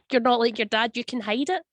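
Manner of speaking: ordinary delivery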